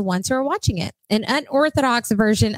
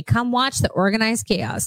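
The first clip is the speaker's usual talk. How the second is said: ordinary delivery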